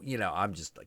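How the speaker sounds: ordinary talk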